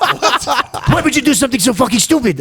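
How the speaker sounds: high-pitched imitated berating; mock outrage. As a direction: mimic an angry star telling someone off. Retold angry confrontation by [name].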